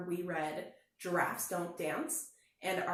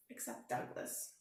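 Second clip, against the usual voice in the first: ordinary delivery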